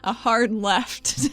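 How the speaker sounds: slow correction. Real, not acted. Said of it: "Oh, okay".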